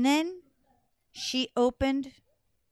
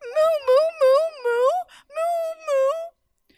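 high, loud tearful mooing; crying moo; 8 dB louder, slower than usual. Acted. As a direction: moo like a weeping cow-headed princess. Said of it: "all she could say".

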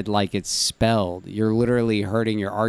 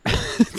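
high burst of laughter. Real, not acted. Mocking friend's pronunciation.

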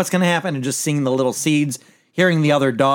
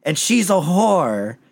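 slow, higher, lightly acted insult; retold insult; 5 semitones higher, slower than usual. Host retelling the uncle insulting the princess, slow mock sneer. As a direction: drag it out like a mild mock sneer. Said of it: "And he's like".

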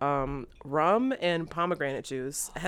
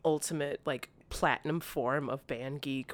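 usual speaking voice

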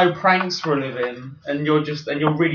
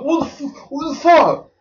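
much higher shocked cry; imitating the victim's shocked outburst; mock outrage; 12 semitones higher, 5 dB louder than usual. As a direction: squeal it in disbelief. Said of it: "And then he was like".

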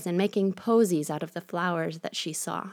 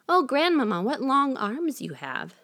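put-on 1 surprised girl; 7 semitones higher than usual. A narrator's reading.

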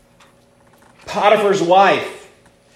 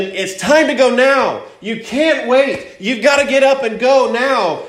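high, insistent urging; emphatic preacher voicing a pressing command; urgent angels; 8 semitones higher than usual. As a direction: drive each phrase home, pitch lifted high. Acts out angels urging Lot.

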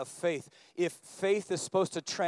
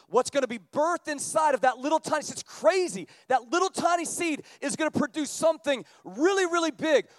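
loud, higher, driving sermon voice; preaching at full force; 10 semitones higher, 7 dB louder than usual. Acted. Pastor pressing the point on seeing with the heart, loud preaching. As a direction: preach it out to the back row. Preaching climax on mustard seed.

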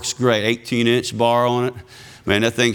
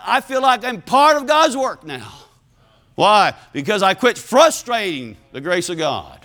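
raised, energetic testimony; fervent preaching; 7 semitones higher than usual. Real, not acted. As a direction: testify with rising conviction. Preaches with fervor.

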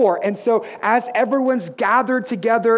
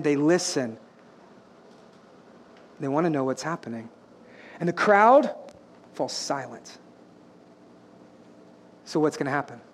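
hushed suspense; 7 semitones lower, 5 dB quieter than usual. Acted. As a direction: drop low and soft, with pauses. Crowd hushes, suspense before sermon.

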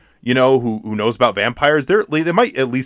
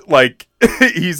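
ordinary delivery

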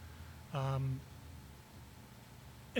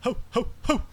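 Santa laugh; 8 semitones higher, 3 dB louder than usual. Acted. Greeting arriving partner.

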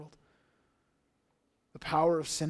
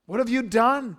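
higher, louder accusing question; sharp raised complaint; accusing cry; 6 semitones higher, 8 dB louder than usual. Acted. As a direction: fling the question at Moses. Quoting Israel's complaint.

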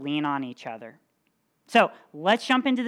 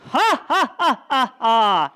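loud, high hammed-up villain laugh; mock evil laugh; terrible giant laugh; 6 semitones higher, 8 dB louder, slower than usual. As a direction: cackle down at the boy. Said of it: "an even terrible laugh".

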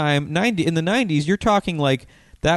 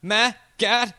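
put-on high, slow valley girl voice; Melanie Hutsell valley girl; 8 semitones higher, slower than usual. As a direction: gush it like a ditzy valley girl. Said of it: "And she would go".

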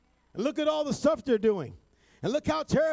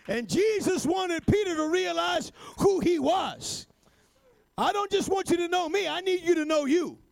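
higher, shouted, rising sermon climax; preaching at full force; 5 semitones higher than usual. Acted. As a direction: build the church up to its feet.

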